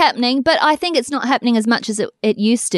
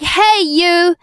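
calling out hey; 5 semitones higher than usual. Pretend. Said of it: "You're like".

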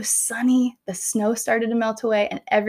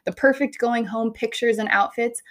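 usual speaking voice

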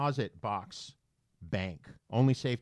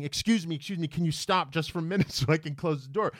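higher, hurried mock plea; acted-out polite begging, pitched up and quick; retold flustered plea; 7 semitones higher, 3 dB louder, faster than usual. Retold panicked plea to the stranger.

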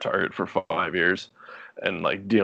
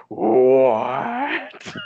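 high, loud, stretched-out what; kid's astonished what; 6 semitones higher, 6 dB louder than usual. Retold or imitated. Boy shocked that Lightning McQueen knew, astonished. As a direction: gasp it like an amazed child.

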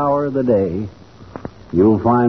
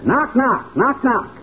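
higher voiced knock knock like a beak on wood; woodpecker knocking; 9 semitones higher, slower than usual. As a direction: tap out the gavel like a woodpecker. Woodpecker calls meeting to order.

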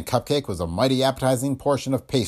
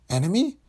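ENEMY tossed back higher, questioning; parroted question; 9 semitones higher than usual. Acted. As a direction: echo ENEMY as a high, rising question. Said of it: "quit parodying everything I say".

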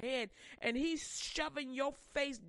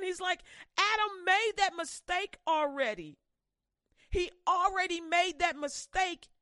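loud, higher fervent preaching; full-force preaching; 6 semitones higher, 6 dB louder than usual. Acted. Preacher driving the point home to his congregation, loud and high. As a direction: build up the preaching and drive it home.

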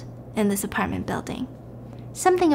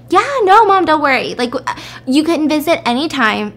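bubbly phone chat; 6 semitones higher, 5 dB louder than usual. Retold or imitated. Woman chatting on phone to mom.

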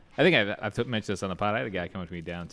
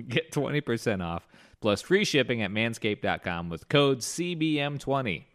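upbeat, scripted sponsor pitch; podcast ad read; 4 dB louder than usual. Acted. Host pitches holiday gifts for the sponsor, ad-read delivery. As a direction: sell it with swinging, punchy emphasis.

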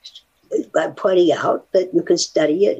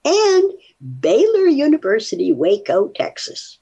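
put-on Texas accent; 8 semitones higher than usual. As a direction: say the university and town like a Texan. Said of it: "Did you like my Texas accent?".

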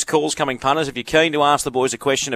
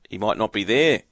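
ordinary delivery

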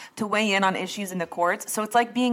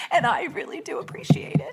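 high, whispery pretend crying; fake tearful; 8 semitones higher than usual. Host thanking listeners for their support, fake tearful. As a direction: act choked up, voice thinning to a squeak. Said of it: "gonna start crying".

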